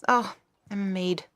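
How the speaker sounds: ordinary talk, nothing marked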